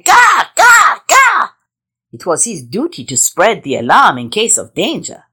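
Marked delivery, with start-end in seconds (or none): 0.0-1.5 high, loud crow call; crow caws. Acted. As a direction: caw like an alarmed crow. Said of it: "He flew into the forest crying".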